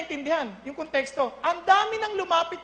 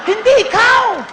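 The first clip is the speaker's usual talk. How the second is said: much louder, higher punchline; emphatic shout; 6 semitones higher, 12 dB louder, slower than usual. Real, not acted. Preacher telling the congregation it's not their fault, shouting. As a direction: belt it out to drive the point home.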